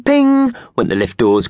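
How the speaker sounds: plain reading voice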